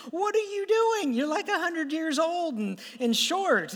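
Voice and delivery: high-pitched mock incredulous jeer; mocking disbelief. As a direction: act out players scoffing at an intruder. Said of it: "They'd all laugh at me".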